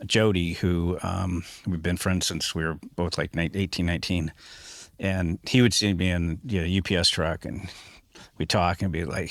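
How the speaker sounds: ordinary talk, nothing marked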